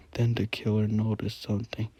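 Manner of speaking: ordinary delivery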